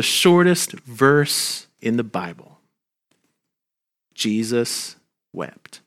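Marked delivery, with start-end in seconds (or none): 4.1-5.8 slow, much softer, weighted words; hushed solemn. Acted. Pastor to mourners, softly hushed. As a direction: let it land softly, slowly. Solemn pause on shortest verse.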